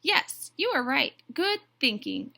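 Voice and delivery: high, loud, enthusiastic approval; eager agreement. A narrator's reading. Duck approves Goose's suggestion, eager agreement. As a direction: agree heartily, voice leaping up. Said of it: "agreed Duck".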